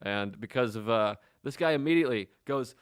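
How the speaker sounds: ordinary talk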